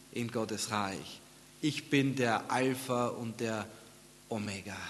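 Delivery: slow, measured litany with weighty pauses; quiet, deliberate preaching; solemn scripture quoting. Real, not acted. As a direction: lean on each "Ich bin", then let it fall softly.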